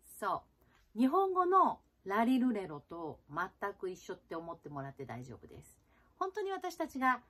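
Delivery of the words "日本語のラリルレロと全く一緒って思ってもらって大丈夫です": normal talking voice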